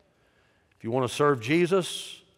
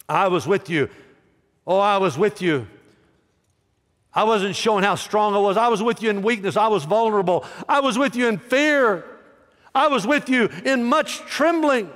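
climbing higher and louder with each line; preaching; 8 semitones higher, 4 dB louder than usual. Acted. Preacher voicing Paul to the congregation, rising and loud. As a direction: cry Paul's confession out from the pulpit.

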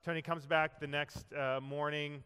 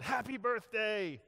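yelled greeting; 8 semitones higher, slower than usual. Retold or imitated. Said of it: "they all yelled".

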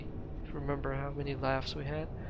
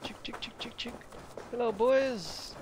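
calling chickens; 6 semitones higher than usual. Pretend. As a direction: coax the birds over.

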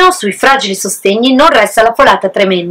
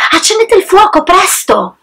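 much higher, urgent shouted command; rally the brothers quickly; urgent order; 9 semitones higher than usual. Acted. Wise pig ordering his brothers to light the fire, urgent. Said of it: "che ordinò subito agli altri".

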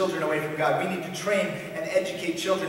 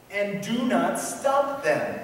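slow, higher-pitched deliberate quote; emphatic scripture command; 5 semitones higher, 3 dB louder, much slower than usual. Acted. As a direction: drive the command home slowly. Reads command back to objector.

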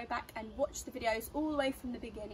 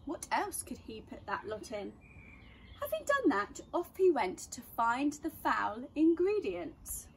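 put-on reading to children; 2 semitones higher, 6 dB louder, slower than usual. A narrator's reading. Reads a rhyming story aloud.

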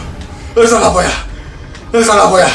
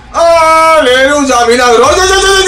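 excited; 8 semitones higher than usual. Real, not acted. Finding shotgun ammo.

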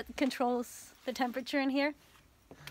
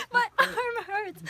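laughing complaint; 8 semitones higher, 4 dB louder, much slower than usual. Real, not acted. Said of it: "holding the phone for too long".

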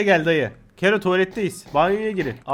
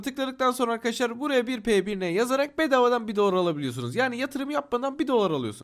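soft, steady sponsor pitch; ad read; 4 semitones higher, 9 dB quieter than usual. Acted. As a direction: read it like a quick ad.

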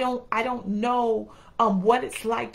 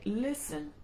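firm tone; 2 semitones higher, 7 dB quieter than usual. Retold or imitated. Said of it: "that voice would go to a more firm tone".

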